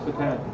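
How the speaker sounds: softly said "Satan" as sa-TAN; Hebrew pronunciation. Shown as a demonstration. Teacher explaining a Hebrew word to a puzzled listener, Hebrew pronunciation. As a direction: say "Satan" softly, stressing the second syllable, sah-TAHN. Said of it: "It's a Hebrew word".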